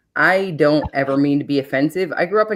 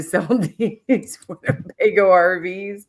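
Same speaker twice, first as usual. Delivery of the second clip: ordinary talk, nothing marked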